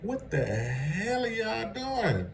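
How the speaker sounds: baffled disbelief. Retold or imitated. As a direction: drag it out in confused disbelief. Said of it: "all of it be looking like".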